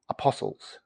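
breathy whispered "Apostles"; whispered emphasis. Acted. Pastor stresses to the congregation that they are apostles, in a whisper.

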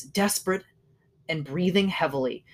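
ordinary delivery

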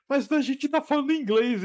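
baffled retort. Retold or imitated. Answering the stranger, high and incredulous. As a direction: say it like you can't believe it.

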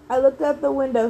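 ordinary delivery, nothing marked

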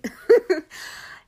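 sudden high bright laugh; burst of laughter. Real, not acted. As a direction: laugh at yourself. Laughs at her own accent.

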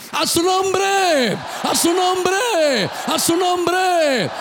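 high, long-drawn preacher's shout; rallying shout. Real, not acted. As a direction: call out to rouse the congregation, stretching every word. Preacher calling congregation to acclaim.

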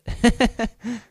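short high, loud laugh; quick burst of laughter. Real, not acted. Speaker owning up to enjoying a cry, high laugh.

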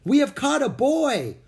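very high, loud announcement; astonished shout. A narrator's reading. Pilgrim man finds a boy in the trap, shouting amazed. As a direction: call it out to the whole crowd.

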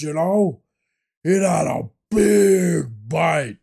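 loud, high, drawn-out character voice; hammed-up sandwich storyteller. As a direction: savour every word slowly and loudly. Hammed-up character monologue about a sandwich.

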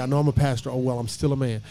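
ordinary talk

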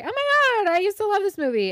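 high, loud squeal of delight; mock gushing. Imitating viewers spotting an old favourite on TV, squealing excitement. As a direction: gush like a thrilled rom-com fan.

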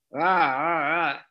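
mock harsh growling noise, briefly loud. Shown as a demonstration. Example of somebody using a harsh voice, harsh mock snarl. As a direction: imitate someone barking harshly without real words. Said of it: "somebody is using a harsh voice".

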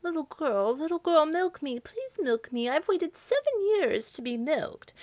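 high, pleading cow voice; lightly voiced cow begging. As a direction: beg the girl to milk her. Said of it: "when the cow said".

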